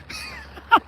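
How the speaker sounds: short laugh after a joke. Real, not acted. Mechanic teasing Bob about the parties, laughing. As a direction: chuckle at your own teasing.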